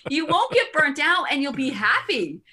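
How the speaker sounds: bubbly laughing encouragement; laughing advice. Real, not acted. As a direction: laugh as you urge them on. Laughing while giving advice.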